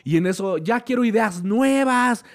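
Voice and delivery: the wolf finally losing patience; fed-up wolf. Retold or imitated. The wolf judge fed up with the auditions, exasperated. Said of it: "se levanta y dice".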